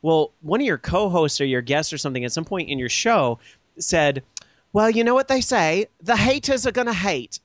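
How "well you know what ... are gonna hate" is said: higher, prim mock-English accent; proper British accent. Host imitates British co-host's saying, proper English accent. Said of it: "that sounded so proper".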